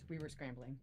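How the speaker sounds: hushed aside to the chat; confiding to viewers. Real, not acted. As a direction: let the viewers in on it quietly. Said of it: "Oh my gosh, you guys".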